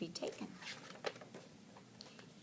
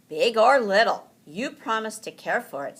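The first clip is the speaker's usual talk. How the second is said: father's reminder; 5 semitones higher, 9 dB louder than usual. A narrator's reading. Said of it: "said his father".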